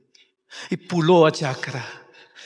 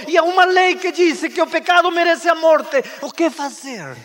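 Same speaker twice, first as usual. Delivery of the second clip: high, loud pulpit build; full-force sermon delivery; impassioned preaching; 11 semitones higher, 6 dB louder than usual. Acted. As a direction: preach it with rising fervour.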